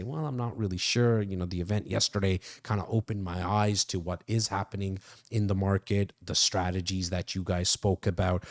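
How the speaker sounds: a low, subdued client reply; lightly voiced hesitant client. Acting out Bo's hesitant reply.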